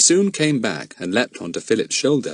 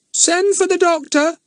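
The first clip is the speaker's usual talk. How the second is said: very high, squawking parrot; hollow parrot voice; 4 dB louder than usual. Acted. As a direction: croak it like a parrot. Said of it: "in a hollow voice".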